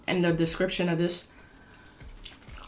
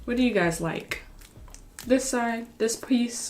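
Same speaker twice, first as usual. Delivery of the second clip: ordinary talk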